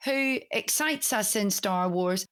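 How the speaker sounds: ordinary delivery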